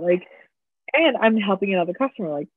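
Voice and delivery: ordinary talk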